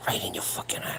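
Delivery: hushed whisper aside; whispered under his breath. Real, not acted. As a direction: drop to a whisper. Whispered aside about staring.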